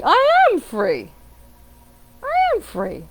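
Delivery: high, ringing, drawn-out declaration; emphatic affirmation. Shown as a demonstration. Coach correcting a weak affirmation, emphatic and certain. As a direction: proclaim it with total certainty. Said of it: "You're not writing an affirmation like".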